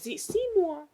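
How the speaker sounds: high, thin breathy Seymour; Ellen Greene impression. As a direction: squeak the name out softly, way up high. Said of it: "Because she says".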